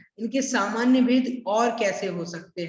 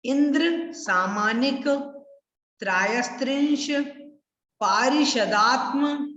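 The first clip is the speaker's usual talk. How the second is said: broken into parts. Shown as a demonstration. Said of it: "कैसे पढ़ना है फिर से देखो एक बार". (टुकड़े टुकड़े करके धीरे धीरे)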